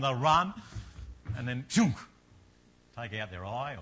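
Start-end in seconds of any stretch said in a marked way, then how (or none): none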